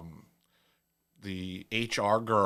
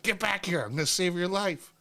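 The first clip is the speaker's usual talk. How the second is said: mock chase call; 10 semitones higher, 3 dB louder than usual. Chasing her down the hall.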